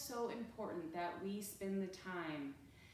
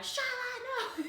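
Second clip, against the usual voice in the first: high sharp call to the dog, laughing; yelling at the dog; 5 dB louder, slower than usual. Retold or imitated. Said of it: "I started yelling at Shyla".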